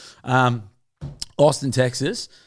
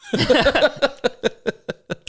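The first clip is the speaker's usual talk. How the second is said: high, staccato run of laughs; real laughter; 12 semitones higher than usual. Real, not acted. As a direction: giggle on and on at your own quip. Laughs after joke about driving.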